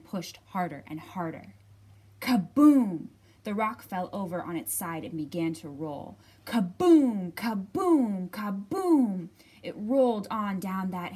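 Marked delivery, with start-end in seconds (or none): 2.2-3.1 loud, falling boom; booming kaboom. A narrator's reading. Rock rolling sound effect. 6.4-9.3 loud, falling boom; booming kaboom. A narrator's reading. Rock rolling sound effect.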